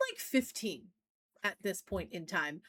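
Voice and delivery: ordinary delivery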